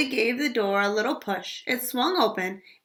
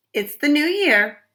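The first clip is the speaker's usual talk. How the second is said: warning; 5 semitones higher, 5 dB louder than usual. Acted. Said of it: "her mother warned".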